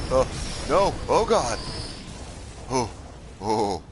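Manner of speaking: high, loud startled cry; panicked oh God; frightened yell. Real, not acted. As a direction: shout out in real fright. Terrifying moment.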